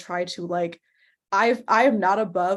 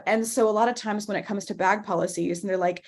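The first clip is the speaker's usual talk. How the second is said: normal talking voice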